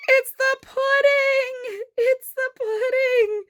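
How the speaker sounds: high, slow, thrilled squeal; high excited squeal over the tiny pudding. Real, not acted. Thrilled discovery, repeated.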